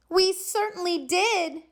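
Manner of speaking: high, eager chorus; excited kids. A narrator's reading. Kids welcoming back their teacher, excited. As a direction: cry out gladly like happy kids. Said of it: "cried all the kids".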